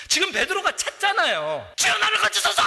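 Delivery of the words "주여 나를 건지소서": high, loud, laughing plea; panicked cry. Acted. Preacher voicing sinking Peter begging Jesus. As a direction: shriek for rescue.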